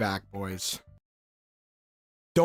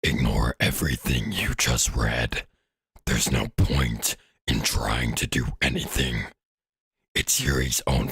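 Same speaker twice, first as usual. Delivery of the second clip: very deep, slow demonic voice; creepy put-on voice; slower than usual. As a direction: drag it out slowly, far down low. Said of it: "I gotta stop doing that fucking voice".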